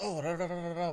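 imitating Italian chatter. Retelling the Nazi greeting the fake filmmakers, mock Italian chatter. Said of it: "Italian starts talking to him".

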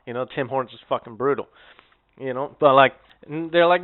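normal talking voice

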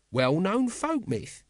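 high, drawn-out know-all; defensive character voice. Acted. Nobby defends his tale of the hoard, defensive. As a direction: insist on it, stretched and high.

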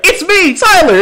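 high-pitched mock-excited announcement; acted eager introduction. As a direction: announce yourself eagerly, as the friend. Acting Tyler introducing himself.

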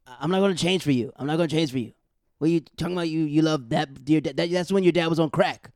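loud, furious rant at his son; angry dad yelling. Retold or imitated. Dad on TV yelling at his loving son, loud and angry. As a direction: chew the son out, raising your voice. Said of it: "he cursed out his son".

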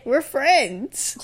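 ordinary delivery

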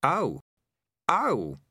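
high, sarcastic "oh"s; nervous sarcasm. A narrator's reading. Rat hearing about the bone rat, nervously sarcastic. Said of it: "nervous to the point of mad sarcasm".

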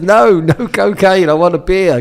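loud refusal. Retold or imitated. Refusing cocaine, demanding beer.

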